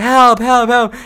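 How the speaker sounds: quick repeated mock calls; imitated yelling. Telling dad about the girl, imitated yelling. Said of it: "she, she's yelling like".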